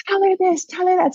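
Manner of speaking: high-pitched, pestering imitation; insistent urging. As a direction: mimic someone pushily feeding messages, voice high. Husband pestering with messages.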